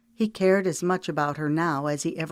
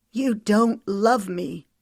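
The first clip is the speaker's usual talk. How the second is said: hoarse; 5 semitones higher, 4 dB louder, slower than usual. Acted. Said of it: "she said hoarsely".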